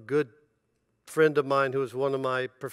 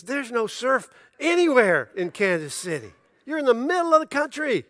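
higher, louder, laughing mock disbelief; incredulous brother; 10 semitones higher, 9 dB louder than usual. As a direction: pitch it up in mock dismay. Brother mocking his move, retold.

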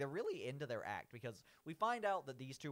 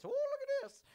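very high, louder Shaggy impression; 6 dB louder than usual. Shaggy admiring the stolen coin, very high Shaggy voice. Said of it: "that's my Shaggy voice".

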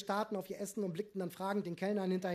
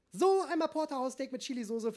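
higher, louder waiter calling out the order; waiter announcing; 7 semitones higher, 6 dB louder than usual. A narrator's reading. Waiter serving Ralf, a bright announcing call. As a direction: announce the dish as you set it down. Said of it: "verkündete der Kellner".

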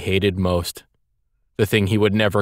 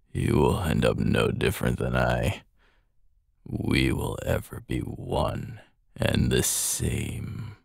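low, slow, soft dying villain; cold rasping evil emperor; 7 semitones lower, 4 dB quieter, slower than usual. Acted. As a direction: intone it as a fallen tyrant.

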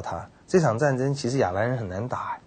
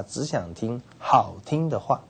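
usual speaking voice

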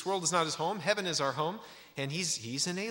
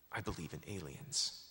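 softer and lower, settled earnest conviction falling at the end; sincere; 5 semitones lower, 9 dB quieter than usual. Retold or imitated. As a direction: state it quietly as a firm belief. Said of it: "with all sincerity".

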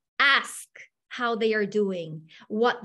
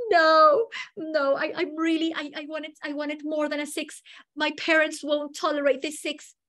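higher, halting mock tearful protest; imitated crying student; 5 semitones higher than usual. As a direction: plead through pretend tears, stumbling over words. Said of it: "and she started to cry".

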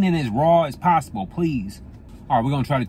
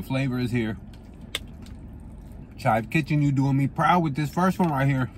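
talking between bites; chewing while talking. Real, not acted. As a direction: mouth partly full of food. Seen 0: holds fork over salad bowl, lips pursed as if chewing between words.

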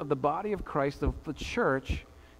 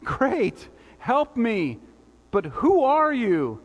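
raised, urgent pleading voice; mildly acted panic, pitched up high; frantic; 5 semitones higher, 4 dB louder, slower than usual. Frantic man clinging to a branch answers the voice, frantic. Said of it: "the frantic man holding on".

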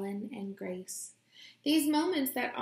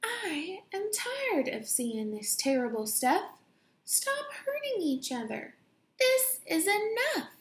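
building to a shout; 9 semitones higher than usual. Acted. Small girl standing up in the lunchroom, rising to a high shout. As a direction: start firm, then climb to a high shout. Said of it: "She said".

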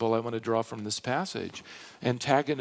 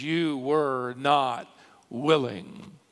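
"You were not willing" drawn out slowly, "not" stretched; slow, deliberate stress through "not willing"; underscored phrase; much slower than usual. Shown as a demonstration. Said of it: "Underscore that last phrase".

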